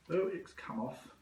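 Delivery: ordinary delivery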